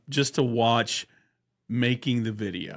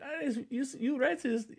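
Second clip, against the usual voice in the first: much higher, squeaky mock voice; Eastbound character voice; 12 semitones higher, 7 dB quieter than usual. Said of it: "He's like".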